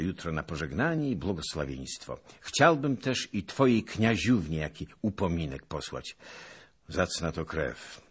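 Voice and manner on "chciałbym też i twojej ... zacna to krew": ordinary delivery, nothing marked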